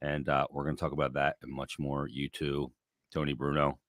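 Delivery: quiet golf announcer hush; soft, like calling a golf tournament; hushed tones. Real, not acted. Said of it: "Why, why are you in hushed tones?".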